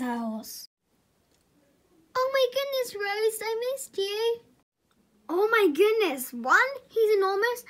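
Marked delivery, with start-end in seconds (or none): 2.1-4.4 joyful reunion. Acted. Reunited with her horse Rose. 5.3-7.6 high, loud, thrilled gush; amazed delight. Acted. Owner first sees new horse.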